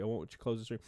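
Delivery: ordinary talk, nothing marked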